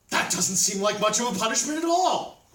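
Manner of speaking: doubting hero speaking up; incredulous objection. A narrator's reading. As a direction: object loudly, unconvinced. Thorstein objects to the demon's answer.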